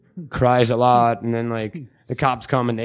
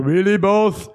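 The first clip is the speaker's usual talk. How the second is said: Gossamer impression; 4 semitones higher, slower than usual. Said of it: "like fucking Gossamer from that Bugs Bunny".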